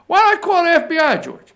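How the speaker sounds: high, fast mock Trump voice; Trump impression. Mocking Trump's TV interview line.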